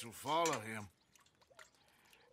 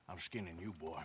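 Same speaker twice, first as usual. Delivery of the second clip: low menacing threat; 11 semitones lower, 6 dB quieter, faster than usual. Acted. To the debtor who owes money, a low quiet threat. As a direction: drop low and soft, cold menace.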